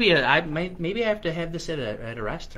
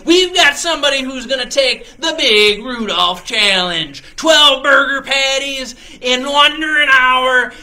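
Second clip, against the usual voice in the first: high, booming showman's holler; cook's loud announcement; 8 semitones higher, 12 dB louder than usual. Acted. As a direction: holler it out to rally the whole café. Cook announces challenge to whole cafe.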